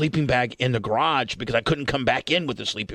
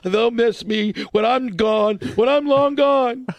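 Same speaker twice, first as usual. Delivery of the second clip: high, slow whiny mock crying; hammed-up weepy child; mock tearful self-pity; 8 semitones higher, slower than usual. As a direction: wail it slowly like a sulking runaway kid. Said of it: "I'm crying".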